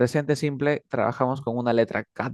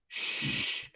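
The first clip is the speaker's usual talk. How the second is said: long hushing "shh"; sustained airy "shh" with no vibration; unvoiced shh sound; 11 dB quieter than usual. Shown as a demonstration. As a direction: demonstrate a voiceless shh. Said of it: "compáralo con el shh".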